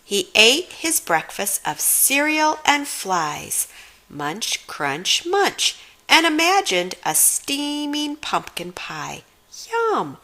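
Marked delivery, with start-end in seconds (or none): none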